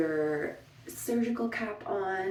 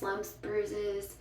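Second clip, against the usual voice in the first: normal talking voice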